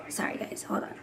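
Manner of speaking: hushed apology, barely voiced; whisper. Real, not acted. Apologizing to viewers while baby sleeps, whispered. Stepping away, baby nearby.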